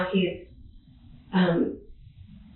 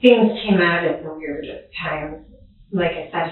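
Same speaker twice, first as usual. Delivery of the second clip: ordinary talk, nothing marked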